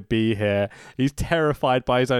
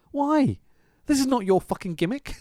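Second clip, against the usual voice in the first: mock exasperation; 10 semitones higher than usual. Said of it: "and you're like".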